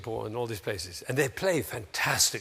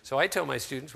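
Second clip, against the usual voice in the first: ordinary talk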